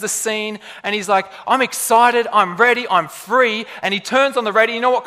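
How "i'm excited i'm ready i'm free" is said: loud, higher, exhilarated outburst; excited. Acted. Preacher plays movie hero who just quit his job, excited. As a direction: burst out with newfound freedom. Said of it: "he's so excited".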